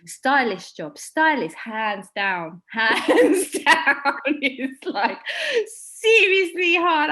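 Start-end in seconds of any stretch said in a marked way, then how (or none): 2.7-7.1 higher and louder, laughing. Real, not acted. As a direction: laugh while stressing it. Laughing emphasis.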